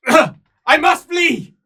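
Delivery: high, loud, drawn-out panic; terrified cry. Acted. Servant flees the ogre, high and terrified. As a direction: scream it while running.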